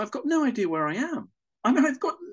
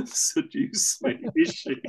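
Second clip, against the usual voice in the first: giggling through the words, higher; laughing; 11 semitones higher, slower than usual. Retold or imitated. Thinking Lourdes means to seduce him, laughing. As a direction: laugh the question out, half whispered. Amused inner thought about Lourdes.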